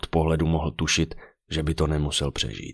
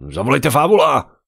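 loud, high cry for help; alarmed call; 9 semitones higher, 8 dB louder than usual. A narrator's reading. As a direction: shout for someone to be fetched.

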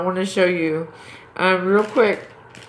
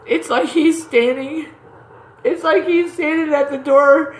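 excited, spirit-filled; 8 semitones higher, 4 dB louder than usual. Real, not acted. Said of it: "I have the Holy Ghost right now so strong".

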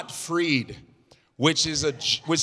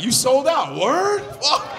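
angry fan; 8 semitones higher than usual. Retold or imitated.

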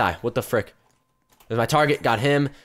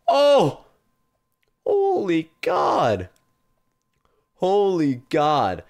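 stunned, slow, drawn-out awe; shrieking jackpot winner; much slower than usual. Real, not acted. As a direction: gasp at a huge win, then stretch it. Opening a jackpot unbox.

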